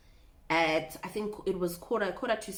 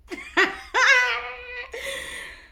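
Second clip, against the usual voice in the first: high, loud burst of laughter; 6 dB louder than usual. Real, not acted. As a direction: crack up at the memory. Laughing at the memory.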